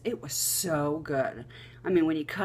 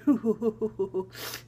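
giggle; 5 semitones higher, 4 dB louder than usual. Retold or imitated. Giggling under the covers.

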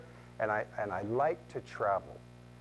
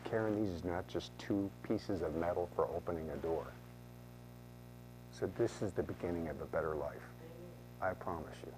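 low, soft and heartfelt; retold tender promise; 7 semitones lower, 6 dB quieter than usual. Agent hands client the keys to their home, tenderly. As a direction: lower and soften it as a solemn promise.

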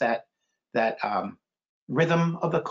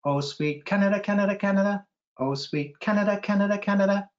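chanted birdsong, rising to a high repeated Canada; held note, then higher rhythmic repeats; sparrow's song; 5 semitones higher than usual. A narrator's reading. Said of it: "add a warm tune to a cold winter morning".

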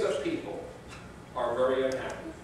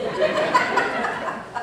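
high burst of laughter; real laughter; big laugh; 6 dB louder than usual. Real, not acted. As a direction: laugh at your own story. Laughs at own story.

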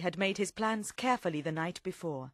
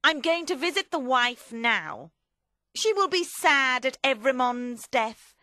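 raised, scheming character voice; forceful, foreboding statement; ominous; 5 semitones higher, 6 dB louder than usual. Acted. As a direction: lay out the plot firmly and a bit loudly. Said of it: "Madame Defarge said ominously".

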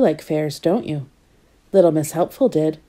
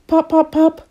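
popping balloons; 9 semitones higher, 4 dB louder than usual. Acted.

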